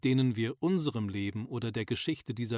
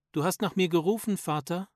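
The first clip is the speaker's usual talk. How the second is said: higher, louder voice for a daughter; lightly voiced daughter, pitched up; answering daughter; 6 semitones higher, 4 dB louder than usual. A narrator's reading. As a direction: answer a father's call with a rising question. Daughter answers her father's call.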